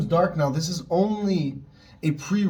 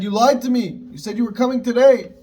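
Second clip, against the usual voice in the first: higher and louder, an indignant accusation; accusing outburst; 8 semitones higher, 7 dB louder than usual. Retold or imitated. Sage confronting Mashiach over the broken promise, raised accusing voice. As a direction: hurl the betrayal back at him, raised and sharp. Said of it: "I don't know if he said in that tone".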